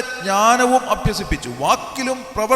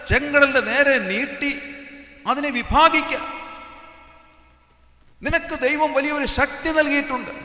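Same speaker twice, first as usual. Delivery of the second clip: higher, sustained pulpit exhortation; exhorting preaching; 5 semitones higher than usual. Acted. Preacher telling believers of power within them, rousing exhortation. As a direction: urge them forward in a raised preaching pitch.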